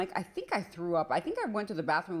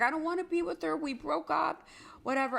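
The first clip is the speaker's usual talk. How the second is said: put-on boyfriend; 10 semitones higher, faster than usual. Boyfriend protesting in quote.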